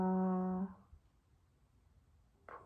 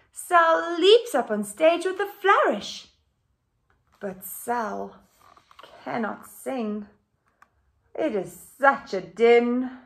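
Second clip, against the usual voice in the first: bright, louder storybook reading; animated picture book read-aloud; reading to children; 5 semitones higher, 7 dB louder, slower than usual. A narrator's reading. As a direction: read it out lively for the class.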